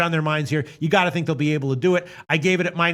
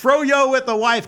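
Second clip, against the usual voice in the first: mock relish; 7 semitones higher, 4 dB louder, slower than usual. Repeats the phrase again, mocking.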